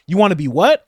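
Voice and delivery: loud mock disbelief; mock challenge. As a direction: demand it back at them.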